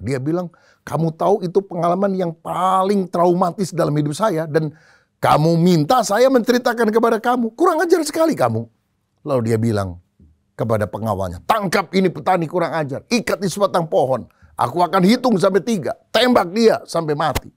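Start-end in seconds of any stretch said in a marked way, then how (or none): 0.8-8.7 offended general, pitch climbing high; jenderal tersinggung. Acted. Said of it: "Tiba-tiba wajah dari jenderal ini berubah". 11.5-17.5 high-pitched, acted order to guards; angry command. Angry general orders his guards.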